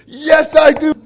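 high, full-volume answer; loud mock shout. Answering the sheikh over the Bible, in a loud mock shout. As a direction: shout it back with hammed-up force. Mimicking the loud reading exchange.

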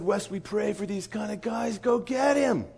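high-pitched, insistent urging. Retold or imitated. Leader sending the missionary after the guy, insistent urging. Acted urging of hesitant colleague.

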